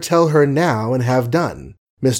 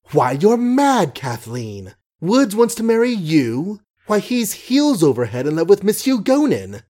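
higher, startled outburst; sudden cry; 7 semitones higher than usual. A narrator's reading. Canaston exclaiming to Kathleen about Woods, sudden high outcry. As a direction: blurt it out in surprise. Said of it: "Canaston cried suddenly".

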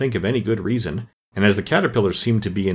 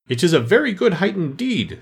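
angry; 9 semitones higher than usual. A narrator's reading. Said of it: "said the caterpillar angrily".

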